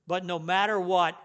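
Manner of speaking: ordinary talk, nothing marked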